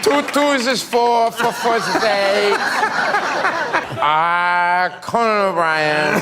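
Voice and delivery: loud, high, slow caricature; Conan O'Brien. Acted. Comic mocking the host, loud slow caricature. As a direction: honk it out long and high. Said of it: "I do a really good Conan".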